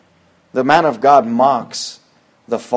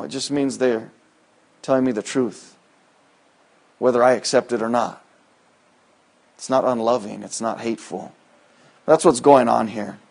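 normal talking voice

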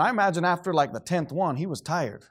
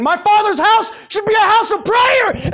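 much higher and louder, slow, roaring rebuke; furious shout; 11 dB louder than usual. Retold or imitated. Jesus drives out money changers, shouting in fury. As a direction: thunder it like a man clearing the temple. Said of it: "He's running around screaming".

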